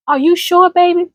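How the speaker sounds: doubt it kindly like a grandmother; elder asking gently. Retold or imitated. Ancestor cautioning a grandchild, gently. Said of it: "when they ask you that".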